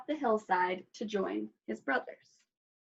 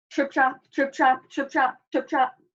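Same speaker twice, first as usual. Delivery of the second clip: loud, lightly pitched-up "trip, trap"; hoofsteps; 5 semitones higher, 5 dB louder than usual. A narrator's reading. Third goat crossing the bridge, loud hoof-step sounds. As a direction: stamp out the hoofsteps with the voice. Big goat's hooves on bridge.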